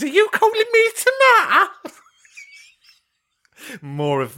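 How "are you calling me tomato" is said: high, loud mock little-kid voice; small child imitation. Host mimicking child in caller's background, high child voice. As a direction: mimic an indignant wee one, pitched right up. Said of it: "And a wee one in the background".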